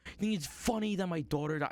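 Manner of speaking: mock furious accusation; hammed-up outraged dad; angry man. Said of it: "he's, like, pissed".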